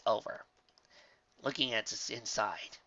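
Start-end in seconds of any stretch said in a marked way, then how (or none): none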